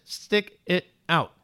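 slow, word by word with gaps; drawn out for emphasis. Real, not acted. Preacher repeating the Lord's answer to the congregation, slow and spaced out. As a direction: space out each word deliberately.

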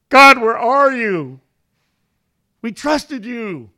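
high, slow, drawn-out wail to God; mock desperate cry. Preacher imagining men thrown into the furnace, crying out in mock despair. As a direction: cry out in hammed-up betrayal.

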